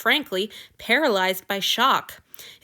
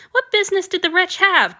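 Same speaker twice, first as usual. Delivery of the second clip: ordinary delivery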